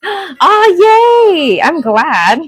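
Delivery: high, loud ah yay; excited cheer. Real, not acted. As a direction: squeal happily. Reacts to viewer comment.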